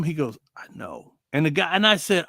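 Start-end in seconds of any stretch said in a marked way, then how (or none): 0.5-1.1 much softer and lower, resigned; quiet resigned reply. Retold or imitated. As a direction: say it barely above a breath, defeated.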